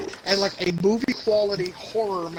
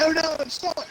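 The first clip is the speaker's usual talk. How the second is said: much higher, hammed-up Australian cry; Australian accent, mock helpless and higher; 10 semitones higher than usual. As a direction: imitate a stuck Aussie in an Australian accent. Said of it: "he was Australian during that part".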